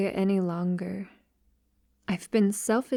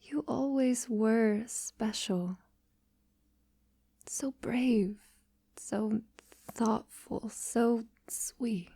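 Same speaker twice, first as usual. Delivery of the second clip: tender whisper. Acted. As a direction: hush it like a lover's reassurance. Tender whispered praise to beloved.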